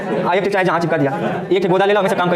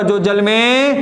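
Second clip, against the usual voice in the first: slow drawn-out prompt; 2 semitones higher, much slower than usual. Shown as a demonstration. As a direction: stretch जो जल में out slowly, waiting for the class to finish it. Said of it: "teacher prompts class to complete".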